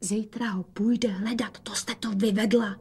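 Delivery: hushed reproach; whisper. A narrator's reading. Daughter scolds mother about the cat, whispering. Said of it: "dodala šeptem".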